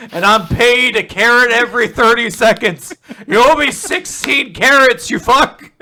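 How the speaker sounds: loud, high, hammed-up rant; mock outrage. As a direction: yell a comic demand for payment. Acted quarrel over horse's payment.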